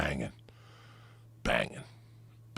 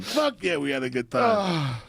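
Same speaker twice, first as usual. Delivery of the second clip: ordinary talk, nothing marked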